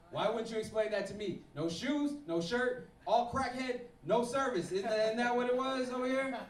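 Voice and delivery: hammed-up indignant confrontation; indignant questioning. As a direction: demand an explanation, building in outrage. Acted angry confrontation with waitress.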